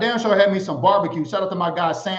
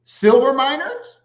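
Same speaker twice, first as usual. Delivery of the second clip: surprised question; 5 semitones higher, 5 dB louder, slower than usual. Real, not acted. Reacting to a surprising fact.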